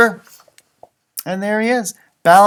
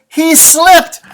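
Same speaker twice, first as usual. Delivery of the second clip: dramatic; 10 semitones higher, 10 dB louder than usual. Acted. Dramatic reading of caption.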